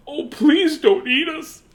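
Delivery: high, slow scared begging; frightened plea. A narrator's reading. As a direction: beg the monster for your lives. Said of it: "cried the".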